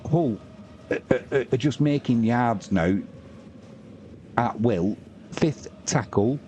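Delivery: ordinary talk, nothing marked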